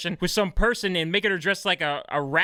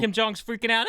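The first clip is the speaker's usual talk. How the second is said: ordinary talk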